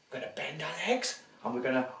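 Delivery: usual speaking voice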